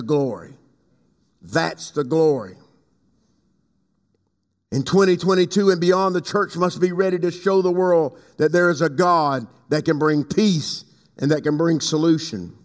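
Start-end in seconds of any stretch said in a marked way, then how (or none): none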